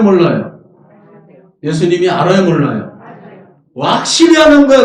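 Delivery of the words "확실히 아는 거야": higher and louder, declared with force; emphatic cry. Real, not acted. Preacher crying out to the congregation, louder and higher. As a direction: proclaim it to the back row.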